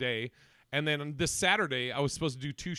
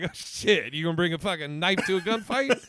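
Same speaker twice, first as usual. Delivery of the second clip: loud laughing cop taunt; 3 semitones higher, 6 dB louder than usual. Retold or imitated. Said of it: "He's like".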